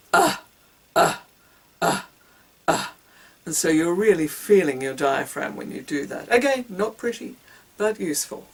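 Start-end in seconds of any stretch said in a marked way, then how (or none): 0.1-2.9 short punched grunts from the belly; grunting. Shown as a demonstration. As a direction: grunt each one separately from way down low. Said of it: "It comes from way down low".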